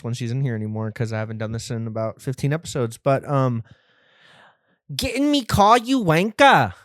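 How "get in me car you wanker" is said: high, loud mock British accent; mock a British bloke telling you to get in his car; loud mocking British "Get in me car, you wanker". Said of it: "That's how they talk".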